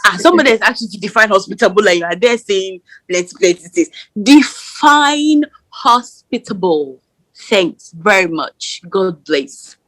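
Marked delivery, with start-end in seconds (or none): 4.2-7.0 very slow, higher, word by word; pointedly drawn out. Real, not acted. Friend demands the others define hospitable, pointedly drawn out. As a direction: stretch it out slowly as a pointed demand.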